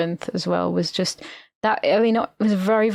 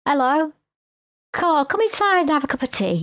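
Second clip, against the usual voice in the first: worm voice; 7 semitones higher than usual. Retold or imitated. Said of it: "she has to ask a worm".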